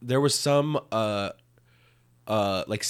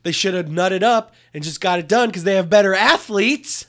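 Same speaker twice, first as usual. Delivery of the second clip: loud, high exasperated rant; frustrated rant; 7 semitones higher, 5 dB louder than usual. Real, not acted. Friend ranting about the local team, loud and exasperated. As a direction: vent about the team blowing it.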